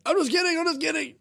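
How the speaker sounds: very high squeaky mock pleading; mock squeaky backpedal; retold backpedal. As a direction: squeak it out to take back a joke.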